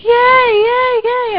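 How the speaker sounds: high gleeful yays; cheering. Retold or imitated. Fans celebrating Carl's return, high cheering yays. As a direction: cheer like a happy fan. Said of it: "and we're like".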